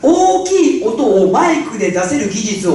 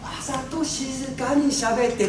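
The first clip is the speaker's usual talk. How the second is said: わざと静かに spoken much softer and slower; deliberately quiet; 10 dB quieter than usual. Shown as a demonstration. Speaker showing the audience deliberately quiet speech, hushed. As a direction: drop to a near hush on 静かに.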